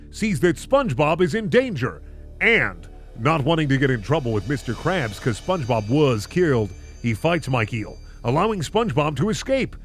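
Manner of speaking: usual speaking voice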